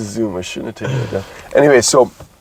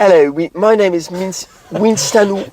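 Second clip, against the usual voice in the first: higher-pitched bad British accent; mock British accent; 6 semitones higher than usual. Imitating Winston Wolf for his co-host, mock British accent. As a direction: put on a hammy British voice. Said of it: "he has a British accent".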